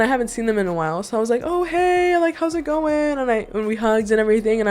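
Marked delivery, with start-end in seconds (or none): 1.4-3.2 1 cheery greeting. Retold or imitated. Acting out greeting to friend.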